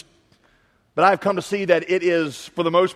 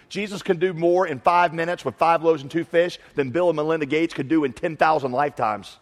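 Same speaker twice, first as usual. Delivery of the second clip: usual speaking voice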